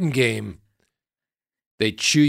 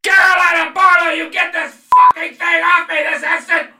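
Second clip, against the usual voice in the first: high, loud shrieking panic; host shrieking at producer to remove the bee; terrified scream; 8 dB louder than usual. Acted. Screaming as bee lands on arm.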